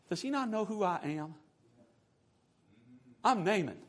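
hushed, incredulous self-importance; quiet, haughty disbelief; affronted pride. Acted. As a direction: announce yourself as if insulted. Naaman indignant at being snubbed.

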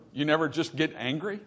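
usual speaking voice

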